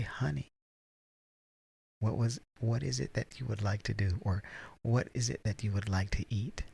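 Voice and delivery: gentle proper honey voice; calm soft voice. Shown as a demonstration. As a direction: speak low-key and proper to calm things. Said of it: "take the bass out of my voice".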